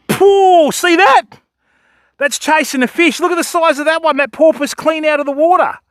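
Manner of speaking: can't believe what he's seeing; thrilled. Real, not acted. Porpoise leaps out of water.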